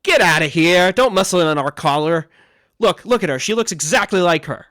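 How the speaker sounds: loud, aggressive challenge; gruff burly guy. Acted. Burly guy warns off a stranger, aggressive. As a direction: raise your voice to warn off an intruder. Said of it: "like, burly guys".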